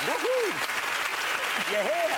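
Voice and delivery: high, loud whoop of welcome; excited cheer. Real, not acted. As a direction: whoop the guest in. Host cheering guest's arrival.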